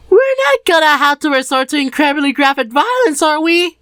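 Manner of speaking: put-on high, wary boy voice; a boy hoping to avoid a fight; Harold asking. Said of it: "asked Harold".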